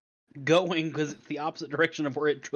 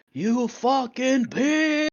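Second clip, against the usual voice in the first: high, loud, drawn-out yell; yelled insult; 9 semitones higher, 4 dB louder, much slower than usual. Acted. Game master voices the horse yelling out, high drawn-out yell. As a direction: yell it out, stretching the words. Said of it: "yells out".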